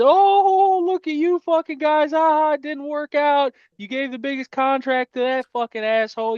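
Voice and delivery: high, jeering voice of rival fans; mocking taunt. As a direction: sneer at us like a gloating rival. Voicing other fans mocking Browns.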